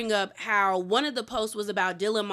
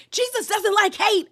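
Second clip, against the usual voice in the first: much higher and louder, a mock indignant Heather; Heather's retort; 9 semitones higher, 5 dB louder than usual. Heather answering Jen, mock indignant. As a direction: snap it back high and loud. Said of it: "you hear Heather say".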